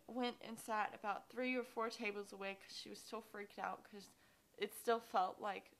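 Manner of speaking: ordinary talk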